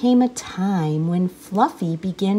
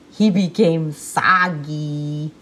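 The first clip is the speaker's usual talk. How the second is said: droopy soggy delivery; 4 semitones lower, slower than usual. A narrator's reading. Punchline of failed bath.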